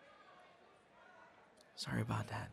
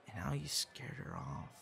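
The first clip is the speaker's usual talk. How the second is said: reproachful whisper; 7 dB quieter than usual. Acted. Reproaching partner.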